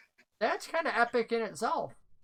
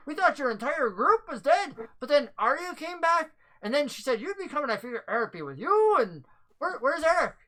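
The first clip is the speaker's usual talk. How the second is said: higher-pitched anxious questioning; concerned NPC, voice raised high; worried villager; 7 semitones higher than usual. Acted. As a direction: ask after a missing friend, pitched up.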